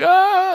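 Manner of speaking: piercing high mock scream. Kidnapped woman shrieking as she's dragged out, high scream. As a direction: shriek like being grabbed. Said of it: "They're like".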